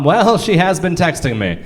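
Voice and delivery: normal talking voice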